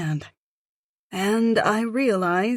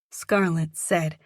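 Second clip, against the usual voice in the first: ordinary delivery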